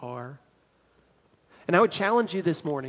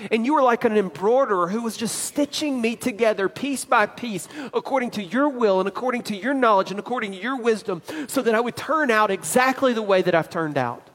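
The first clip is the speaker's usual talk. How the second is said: louder, higher sermon drive building to a peak; full preaching; 4 semitones higher, 5 dB louder than usual. Acted. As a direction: preach it out to the congregation, pressing each phrase.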